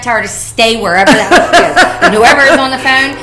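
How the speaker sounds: laughing. Real, not acted. Laughing joke.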